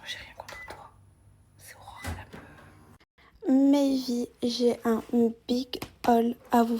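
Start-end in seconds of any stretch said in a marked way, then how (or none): 0.0-2.4 soft unvoiced whisper. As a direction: murmur it close to the mic. Whispered clip intro.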